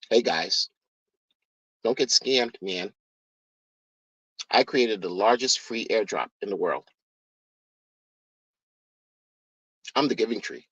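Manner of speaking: low, hushed mock of a crypto founder; a low, soft send-up of the guru's pitch.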